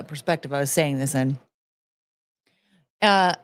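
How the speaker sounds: usual speaking voice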